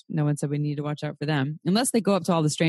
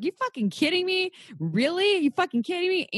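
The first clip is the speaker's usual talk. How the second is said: high incredulous outburst; retold outrage; 12 semitones higher than usual. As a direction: replay the disbelief at the email, way up. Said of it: "yes, I was angry then".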